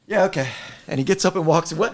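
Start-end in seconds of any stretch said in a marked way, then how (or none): 0.1-0.5 dismissive chief. Retold or imitated. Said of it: "pats me on the shoulder and goes".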